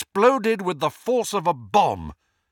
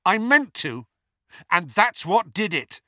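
raised, insistent reply; defiant retort; 7 semitones higher, 4 dB louder than usual. A narrator's reading. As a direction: answer back firmly and loudly. Harry answers back, defying Voldemort.

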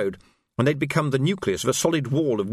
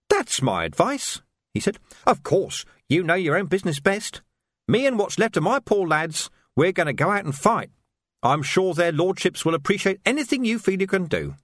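smooth it over with fake politeness; mock-polite thug; 7 semitones higher than usual. A narrator's reading. Carcer leaving the Major with sly mock courtesy.

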